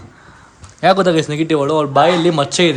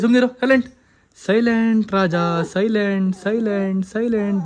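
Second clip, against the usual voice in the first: soothing hush; 4 semitones higher, slower than usual. Real, not acted. Hushing a crying child. (ಮಗುವನ್ನು ಸಮಾಧಾನಿಸುವ ಎತ್ತರದ ದನಿ)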